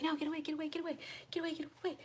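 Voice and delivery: high-pitched, repeated frantic shooing; panicked retelling. Retold or imitated. Woman retelling being chased by a bee, high panicked pleading. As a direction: act out fending something off in a panic. Retold fleeing from chasing bee.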